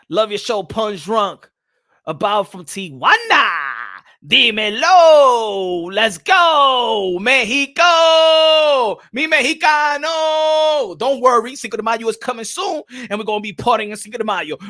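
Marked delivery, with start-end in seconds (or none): 4.3-11.0 slow stretched hype shout; stretched excited cheer. Real, not acted. Hyping Mexican viewer.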